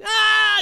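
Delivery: high, loud mock shriek; hammed-up scream of panic; overdramatic freak-out scream. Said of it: "you get like Piglet".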